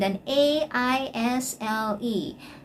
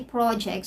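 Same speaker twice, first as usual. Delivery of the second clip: "project" stressed on the first syllable; "project" said PRAW-ject; noun stress. Shown as a demonstration. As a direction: lean on PRO in "project". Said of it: "it's either a program".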